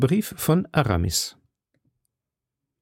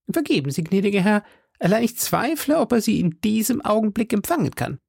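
high, deferential landlady voice; high-voiced hostess gently putting off a guest; apologetic innkeeper woman; 10 semitones higher than usual. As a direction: excuse yourself politely as the hostess, pitched high. Innkeeper politely doubts a visit.

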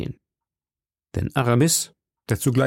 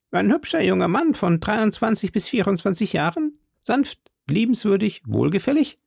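high-pitched, friendly landlady voice; high voice of a helpful innkeeper woman; cheerful innkeeper woman; 9 semitones higher than usual. Acted. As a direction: play the obliging hostess, pitched well up. Innkeeper's dialogue in story.

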